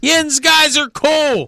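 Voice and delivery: high, slow Pittsburgh boast; excited Pittsburgh cheer. Pretend. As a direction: draw out Yins guys with pride. Hometown shout.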